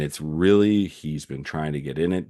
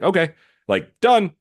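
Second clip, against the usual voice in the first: high, loud and breezy, brushing it all off; carefree brush-off, pitched way up; dismissive shrug-off; 8 semitones higher, 4 dB louder than usual. Retold or imitated. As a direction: toss it away like it's finished business. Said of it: "so now he's like".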